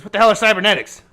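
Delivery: can't fathom the word; baffled old man. Acted. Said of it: "He says".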